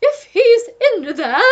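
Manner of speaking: high, loud, slow diva voice; Duchess crying out. A narrator's reading. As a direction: demand it grandly. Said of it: "cried the Duchess".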